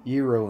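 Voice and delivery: GYRO as "YEE-ro", loud on the first syllable; Greek pronunciation. Shown as a demonstration. Streamer correcting partner, gyro as Greek "yee-ro". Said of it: "Gyro in Greek".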